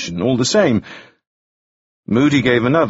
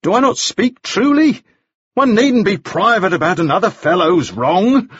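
high-pitched, insistent crying out; very loud; 10 semitones higher than usual. A narrator's reading. Balfour argues against Moody's privacy, being very loud. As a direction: push the pitch right up, carrying to the room. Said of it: "He was being very loud".

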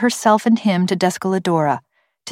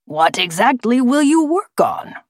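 higher, taunting gravelly bird; forced, gravelly voice; 6 semitones higher than usual. A narrator's reading. Bird taunting scientists without their equipment, forced gravelly voice. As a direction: needle them with a rising question. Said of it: "gravelly voice".

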